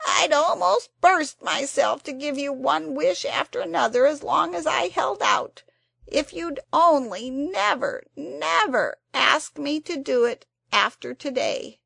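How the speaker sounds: high, loud, tearful creature. A narrator's reading. The Psammead tearfully begs Anthea to spare it. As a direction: plead through tears of self-pity. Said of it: "it said in a tearful voice".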